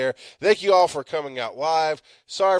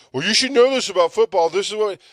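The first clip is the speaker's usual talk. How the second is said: lightly mocked football coach, higher and louder; mocking coach; 7 semitones higher, 5 dB louder than usual. Podcaster mimicking the football coach dismissively. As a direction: sneer at the coach's excuse. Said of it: "Football coach go".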